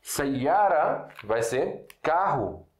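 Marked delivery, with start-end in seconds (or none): 0.0-1.1 "Sayara" said slowly with each syllable spelled out, ending on A; slow, careful Arabic word. Shown as a demonstration. Teacher slowly models "Sayara" for students, ending on A. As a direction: draw "Sayara" out syllable by syllable and land on A. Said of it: "que vamos usar Sayara".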